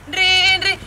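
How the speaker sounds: high, loud ringing noise; imitated phone ring. Phone interrupting the plea to switch it off, voiced ringing. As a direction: mimic an insistent telephone at full voice.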